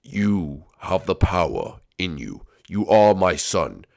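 slow, low, portentous voice; deep, drawn-out apparition voice; ghostly father. Acted. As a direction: bestow wisdom slowly as a ghost dad.

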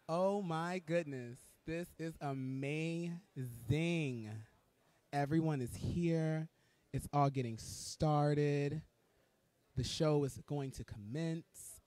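slow, stretched, savouring every word; grand slow announcing. Real, not acted. Host announcing the show is starting, slow and grand. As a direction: draw it out like a grand opening.

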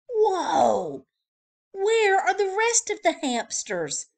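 high, loud astonishment; astonished. Acted. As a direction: gasp it in disbelief. Shocked by hamsters.

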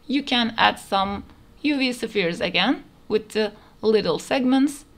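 ordinary talk, nothing marked

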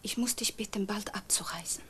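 almost no voice, ashamed to ask; hushed plea. Acted. Daughter-in-law asks mother to leave, in a whisper.

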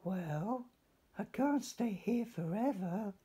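Stanley alone in the cave, sighing; little fish sighing. Acted. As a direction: sigh it out as tiny Stanley. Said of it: "he sighed at last".

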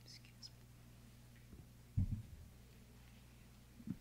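breathy hushed whisper; barely voiced, under the breath. Whispered aside during phone call.

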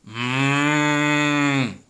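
mooing cow. A narrator's reading. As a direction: draw out a moo like a cow. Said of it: "said Cow".

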